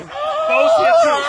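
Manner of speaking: high excited shout. Real, not acted. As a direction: call it out, thrilled. Excited calling out on field.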